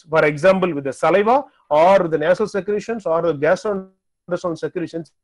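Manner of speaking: ordinary delivery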